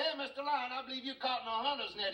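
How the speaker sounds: high, fast mouse voice; excited little mouse. Acted. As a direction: pipe up quickly to the big lion. Mouse calls to the trapped lion.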